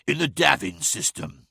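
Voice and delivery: plain reading voice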